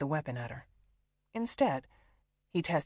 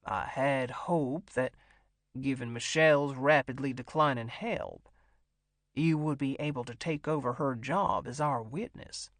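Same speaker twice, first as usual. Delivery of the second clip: deliberate, controlled man lording it over his hostage; menacing captor; 3 semitones lower than usual. A narrator's reading. Figure of Dash in story narration.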